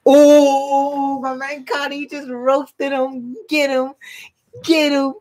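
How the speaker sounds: very high, drawn-out hype cry; high-pitched crowd-style egging on; hyped-up reaction. Real, not acted. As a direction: whoop it up over a savage burn. Hyping up a character's roast.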